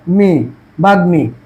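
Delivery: slowly separating মি, then বাগ্মী with a clear ম; pronunciation demo. Shown as a demonstration. Teacher showing class that ম is kept in বাগ্মী, slow demo. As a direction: split বাগ্মী as বাগ্-মী, slowly. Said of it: "তারপর কি হবে?".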